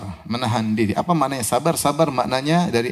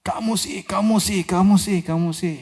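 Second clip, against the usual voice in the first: mock blaming nag; 7 semitones higher than usual. Acting out someone blaming another.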